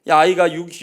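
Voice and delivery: ordinary delivery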